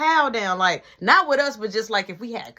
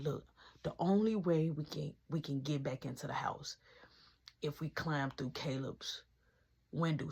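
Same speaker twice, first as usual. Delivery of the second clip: low hushed whisper; 8 semitones lower, 14 dB quieter than usual. Retold or imitated. Whispering the sneak plan to friend. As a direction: lay out the plan under your breath.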